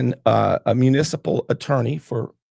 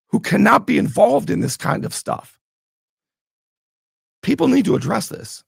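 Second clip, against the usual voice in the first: higher-pitched, heated insistence; fired up; 7 semitones higher than usual. Real, not acted. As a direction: press the demand with real exasperation. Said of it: "I didn't mean to get this fired up".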